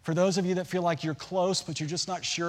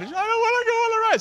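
put-on much higher, louder whiny child; scared little girl; 8 dB louder, faster than usual. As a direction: squeal it like a frightened kid. Daughter scared on the ride.

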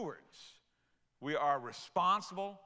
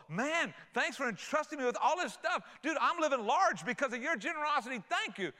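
bright, laughing thanks, pitched up; grateful steward; 7 semitones higher than usual. Retold or imitated. Servant thanking his master, laughing and excited. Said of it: "They're grateful".

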